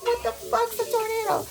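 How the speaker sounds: put-on high, baffled kid voice; clueless children asking what a tornado is; confused kids. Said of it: "And we were like".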